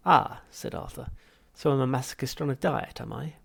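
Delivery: loud, high mocking retort; sarcastic jab. Arthur mocking the machine's claim, sarcastic. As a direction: land the sarcasm hard.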